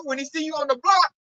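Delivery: ordinary talk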